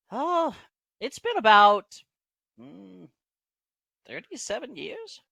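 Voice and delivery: high-pitched halfling voice; high little halfling, recalling as he goes; Dabbledob. Acted. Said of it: "Dabbledob back in Halfling says".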